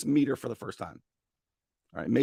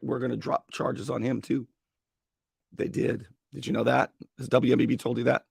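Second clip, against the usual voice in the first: ordinary delivery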